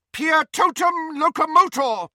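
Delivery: very high, loud spell cry; cried incantation. A narrator's reading. Professor casts on statues along the corridor, crying out. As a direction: cry out the spell with full force. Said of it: "cried Professor McGonagall".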